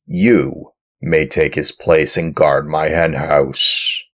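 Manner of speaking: loud, rough, commanding farmer. Acted. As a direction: order the puppet about roughly. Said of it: "said to him roughly".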